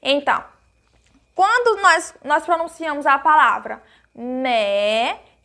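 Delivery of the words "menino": "menino" split into me, ni, no with pauses; syllable by syllable. Shown as a demonstration. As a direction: draw out each syllable of "menino" separately. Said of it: "nós temos que pronunciá-la bem pausadamente". ("menino" pausado, sílaba por sílaba)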